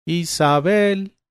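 high, drawn-out call across the garden. A narrator's reading. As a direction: call her name long and high from afar. Friends calling her from garden.

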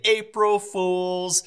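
put-on high, slow, drawn-out kid taunt; little kids' voice. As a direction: sing it out like a gleeful little kid. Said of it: "I hear my two little kids say".

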